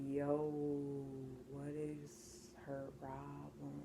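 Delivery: very soft, low, drawn out; slow near-whisper of disbelief; muttered aside. Retold or imitated. Au pair wondering about the yelling mom, hushed aside.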